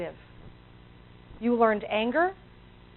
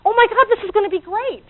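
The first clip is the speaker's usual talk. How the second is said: higher, bubbly burst of enthusiasm; excited new idea; 8 semitones higher, 4 dB louder than usual. Retold or imitated. Audience hearing about a new thought, excited gush. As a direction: gush over a fresh idea, mock-excited. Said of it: "So we get excited about it".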